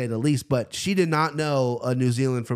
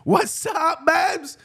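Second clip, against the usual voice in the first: very high, playful squeal; hyped falsetto greeting; much slower than usual. Pretend. Host greeting at show opening, hyped high-pitched voice. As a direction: call it out in a silly falsetto.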